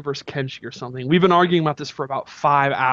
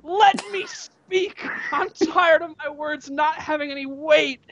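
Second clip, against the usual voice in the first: imitated high-pitched furious outburst; losing it; 10 semitones higher, slower than usual. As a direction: mimic someone snapping, way up high, stretching the words. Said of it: "He absolutely loses it. He stands up".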